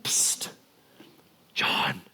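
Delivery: hushed psst to a friend; whisper. Retold or imitated. Peter whispering to John at dinner, sneaky hush. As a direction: whisper secretly across the table. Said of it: "Peter goes".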